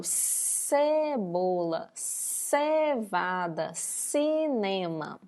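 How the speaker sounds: cebola, cevada, cinema very slow, the c drawn out as a hiss of s; stretched S sound. Shown as a demonstration. Teacher slowing cebola, cevada, cinema so the class hears the s. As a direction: draw out the s sound of cebola slowly. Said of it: "Tô aumentando um pouquinho o som".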